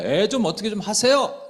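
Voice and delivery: high, loud exasperated demand; mock scolding parents. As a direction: snap at the parents in mock irritation. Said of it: "이렇게 해야지는 속마음인데". (부모에게 짜증내듯 높고 큰 소리로)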